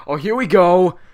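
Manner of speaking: loud, high announcer shout; excited call. Real, not acted. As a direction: shout it as the action kicks off. Calls exciting moment in match.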